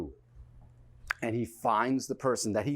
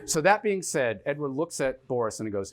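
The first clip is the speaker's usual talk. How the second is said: normal talking voice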